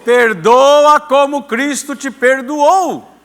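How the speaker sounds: slow, loud, group unison reading; reading together. Real, not acted. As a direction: read the verse aloud with everyone. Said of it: "Todo mundo comigo".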